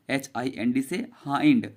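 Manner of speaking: normal talking voice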